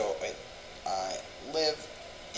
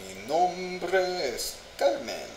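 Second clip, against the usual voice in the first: drawn out, higher and slow; slow careful repeat; 7 semitones higher, much slower than usual. Shown as a demonstration. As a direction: stretch each word like practice.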